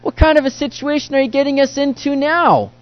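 high, loud, anxious complaint to the Lord; panicked Israelites. Retold or imitated. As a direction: plead like a trapped people cornered at the sea. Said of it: "are wondering".

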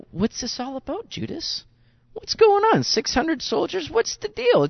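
high, bewildered demands; a bit of an outraged Judas; mock indignant Judas. Judas bristling at Jesus in the garden, high and baffled.

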